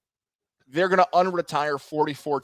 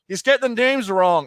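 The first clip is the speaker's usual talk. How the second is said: raised, higher complaint from the stands; louder mock heckler; heckler's call; 7 semitones higher, 7 dB louder than usual. Imagined fan complaining about the names, mock heckler's call.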